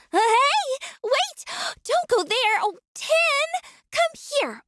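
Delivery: high alarmed calls after a dog; calling out. Acted. Shopkeeper calling after the dog Tin, high alarmed calls. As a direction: chase the dog with high urgent cries.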